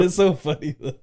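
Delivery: laughing loudly through words. Real, not acted. Laughing while talking.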